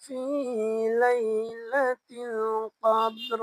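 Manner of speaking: very slow, stretched tajweed chanting; slow Quran recitation. Shown as a demonstration. Teacher reciting the verse for students to repeat. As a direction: recite slowly for the class to follow. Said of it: "আমার সঙ্গে আপনারা পড়ার চেষ্টা করবেন".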